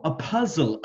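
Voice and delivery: ordinary delivery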